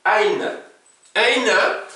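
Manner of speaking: teacher's model word. Shown as a demonstration. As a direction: present "Aina" as a word to repeat. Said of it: "pronom interrogatif".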